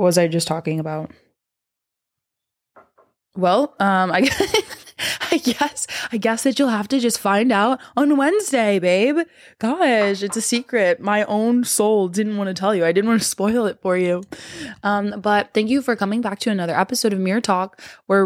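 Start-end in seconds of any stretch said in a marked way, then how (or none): 4.1-14.2 high, playful and coy; teasing. Pretend. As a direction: tease them with a secret. Teasing secret about next episode.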